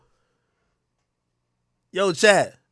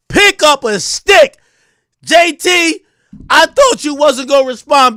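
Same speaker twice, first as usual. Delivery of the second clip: fed-up rant; 6 semitones higher, 5 dB louder than usual. Real, not acted. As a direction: yell at the tweeting rappers to fight for real. Ranting at rappers who tweet.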